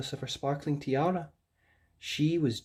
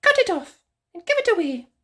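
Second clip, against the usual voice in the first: very high, prim bird voice; Sylvia the bird; 7 dB louder, faster than usual. Acted. Haughty bird correcting Rapunzel, very high voice. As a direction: squeak it out like a haughty little bird.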